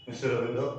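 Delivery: रविंदर with the last sounds swallowed; English pronunciation. Shown as a demonstration. Teacher repeating the English way, swallowed ending on रविंदर. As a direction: let the ending of रविंदर vanish, English style. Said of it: "गायब है".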